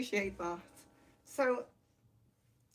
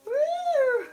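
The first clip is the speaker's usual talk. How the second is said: much higher, much louder whoop; excited whoop; 12 dB louder than usual. Real, not acted. Vlogger as her sparkler flares, excited whoop. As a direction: let out a joyful cheer.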